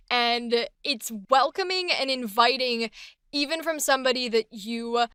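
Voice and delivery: normal talking voice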